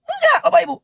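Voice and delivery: very high, sharp emphatic no. Real, not acted. Host answering his own question to viewers, emphatic high no. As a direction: jump way up on the denial.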